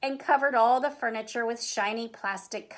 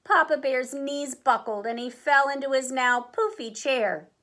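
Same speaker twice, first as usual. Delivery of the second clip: ordinary delivery, nothing marked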